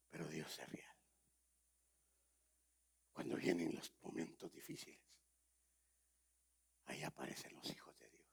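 hushed preacher's whisper, breath only. Acted. Preacher whispering to the congregation about hard times.

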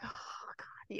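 unvoiced, swooning whisper; hushed delighted whisper. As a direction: breathe it out in rapture. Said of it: "I'm like".

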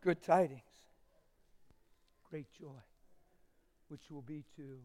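soft, reverent sermon voice, near a whisper; hushed, reverent preaching that fades into a whisper. Acted. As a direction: draw them in, almost whispering.

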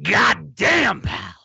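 loud, high, throat-straining boss impression; Vince McMahon. Co-host showing how the boss impression should hurt, loud and strained. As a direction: push it up high and hard from the throat. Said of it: "if your throat doesn't hurt after doing".

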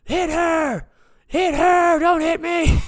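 high, loud, frantic shouts; mock cowardly yelling. Beaten man yelling at the attacker, high frantic shout. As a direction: shout it high to save your own skin. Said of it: "And I'm yelling".

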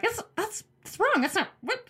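high, startled panic; mock panic, voice jumping up; panicked. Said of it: "I'm like".

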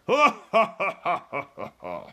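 giant's villainous belly laugh; roaring laughter. A narrator's reading. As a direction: laugh loudly like a gloating giant. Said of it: "roaring with laughter".

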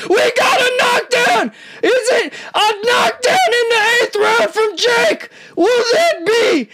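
real high yell at a sudden knockdown; excited shout. Real, not acted. Knockdown watched live, shouting.

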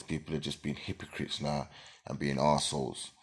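normal talking voice

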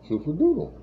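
put-on high cutesy baby talk. Shown as a demonstration. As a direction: coo it like talking to a baby. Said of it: "A baby talk".

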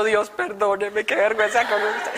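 understated tearful confession, slowed down; imitated tearful apology. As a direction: beg forgiveness with a touch of mock crying. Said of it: "ahí sí ya comencé a llorar".

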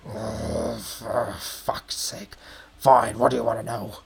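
low, muttered goblin resignation; grudging goblin barkeep. Acted. Goblin gives in to a questioner, grudgingly. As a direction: give in under your breath.

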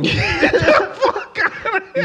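high, slow, laughing get-out-of-here; laughing disbelief. Real, not acted. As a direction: laugh off the accusation.

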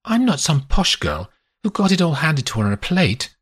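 understated indignation; a woman refusing to be called privileged; mild anger. A narrator's reading. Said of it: "She is angrier now".

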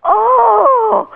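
high loud emotional "Oh!"; moved cry. Retold or imitated. A bragger melting after praise, high moved cry. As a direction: let out a high, touched cry. He melted before my eyes.

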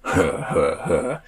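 slow, spaced huh-huh chuckle; wolf laugh. A narrator's reading. Wolf laughs at Ollie's joke, slow chuckle. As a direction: laugh in separate, mechanical huhs. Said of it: "laughed the Fluffy Sun Wolf".

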